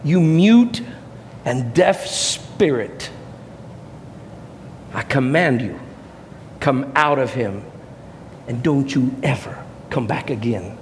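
imitating a solemn, authoritative rebuke; awesome commanding voice. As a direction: order it out with weighty pauses between phrases. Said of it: "in an awesome commanding voice".

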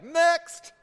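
high, loud summons; calling next. Acted. Moses dismissing a villager's case, high loud call. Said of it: "Moses is like".

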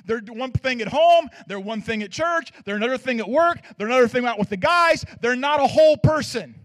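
loud, building preacher's cry; preaching at full force. Acted. Pastor preaching to the congregation, loud building cry.

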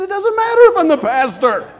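loud high insistent reply; emphatic retort. Retold or imitated. Pastor retorting to a church member, loud and emphatic. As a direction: fire it back, firmly. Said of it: "I said".

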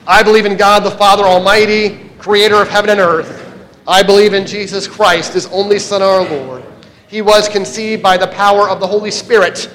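loud, steady unison recital; creed recitation. Acted. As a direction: proclaim the creed together.

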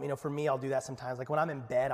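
ordinary talk

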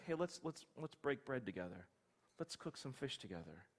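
Jesus welcoming Peter back softly; gentle invitation. Acted.

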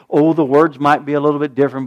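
ordinary talk, nothing marked

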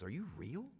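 soft breathless whisper, slowed; hushed, stunned disbelief; awed whisper. Retold or imitated. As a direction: whisper in wonder as she touches him.

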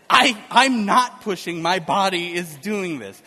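imitating wife, slow and emphatic; slow, forceful retold protest, loud at the start; indignant protest. Laboring wife answers husband's advice not to push, indignant.

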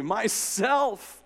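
high and bright, a joyful ring. A narrator's reading. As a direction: ring it out gladly.

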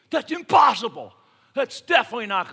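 loud, high "impossible" flung out; shout. Acted. Preacher voicing the congregation's doubt, loud shout.

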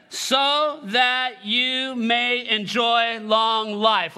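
very slow, word by word; leading group reading. Real, not acted. Preacher leading the congregation in reading scripture, very slow. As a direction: draw out each word so all can join. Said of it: "let's read this together".

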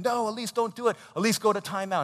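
high, loud mock scolding; acted parental telling-off; exasperated parent. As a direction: order a naughty child firmly. Acting parent scolding playful daughter.

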